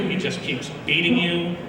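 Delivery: usual speaking voice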